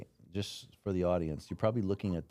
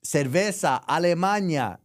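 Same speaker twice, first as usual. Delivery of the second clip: higher and louder, spelling it out for a stranger; loud broken Spanish; 9 semitones higher, 7 dB louder than usual. Retold or imitated. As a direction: call it out loud and high, like ordering abroad. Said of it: "I'm like".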